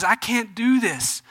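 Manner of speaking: slow, drawn-out fall on "do"; despairing. Retold or imitated. Peter losing heart on the water, slow despair. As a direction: stretch "I can't do this" out, sinking.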